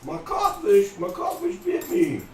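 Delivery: a bad try at a small British boy's accent; British child accent. Host retelling the fishing kids, badly faked British boy accent. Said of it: "Can't do the accent, the voice".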